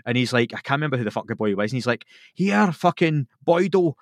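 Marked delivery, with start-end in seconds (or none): 2.3-3.9 high, slow, raised warning call; slow, firm mock shout; commanding warning. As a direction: warn him off like the local hard man. Friend warns off the mugger.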